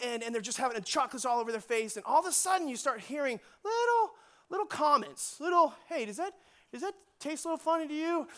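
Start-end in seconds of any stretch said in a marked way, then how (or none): 5.9-8.3 higher-pitched, puzzled mock teen; imitated wary teen; suspicious teenager. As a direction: tentatively ask as a kid who tastes something off. Voices suspicious teenagers eating brownies.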